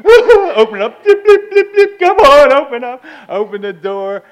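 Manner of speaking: loud, high, desperate pleading; frantic mock sobbing. Weeping newcomer struggling to open his truck, frantic. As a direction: beg the lock through hammed-up sobs.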